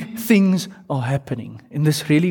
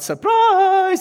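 a high, loud party shout; shouted surprise; 3 dB louder than usual. Retold or imitated. Friends jumping out at an unsuspecting guest, shouting. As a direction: yell it as they walk in. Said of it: "someone would shout".

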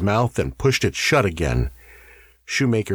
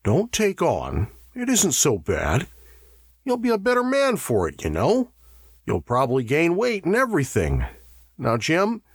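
higher-pitched, hesitant consoling; 8 semitones higher than usual. A narrator's reading. Davies trying to console Jim at the table, hesitant. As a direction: coax him gently, unsure of yourself. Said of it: "Davies said hesitantly".

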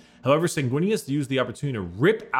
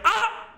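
sudden high screaming cry; death cry. Retold or imitated. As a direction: shriek like you're dying. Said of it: "emoji dying scream".

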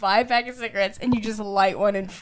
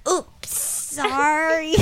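mock sheepish; 5 semitones higher, much slower than usual. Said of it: "I'm like".

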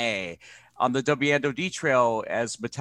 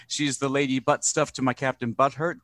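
ordinary talk

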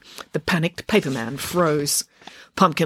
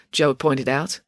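ordinary delivery, nothing marked

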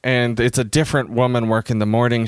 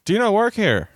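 puzzled workman; 11 semitones higher than usual. Retold or imitated. Retold workman questioning her at bookstore.

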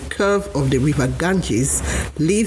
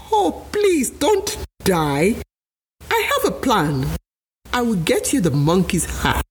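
husband alligator soothing his wife, much higher; assuring wife; 5 semitones higher than usual. Acted. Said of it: "Mister Alligator assured his wife".

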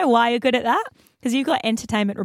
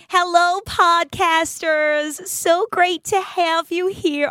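put-on higher, very slow, sing-song valley girl greeting; LA girl accent; 6 semitones higher, much slower than usual. Host greeting listeners in a put-on LA girl accent. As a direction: drag out each word like a bubbly LA girl. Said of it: "an L A girl if you want".

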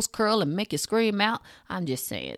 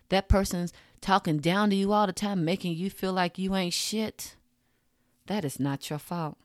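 ordinary delivery

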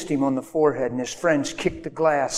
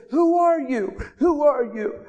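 much higher, shrill amazed cry; startled woman; 12 semitones higher, slower than usual. Retold or imitated. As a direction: voice her stunned disbelief. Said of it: "And she said".

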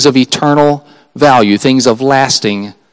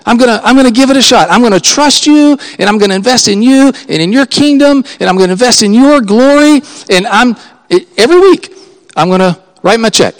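higher, eager vow to God; committed prayer; 6 semitones higher than usual. Pretend. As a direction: promise God with rising excitement.

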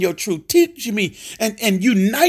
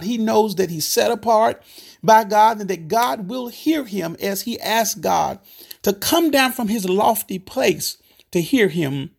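ordinary talk

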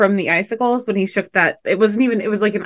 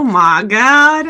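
put-on Southern accent; 5 dB louder, slower than usual. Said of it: "your normal voice don't sound like this".

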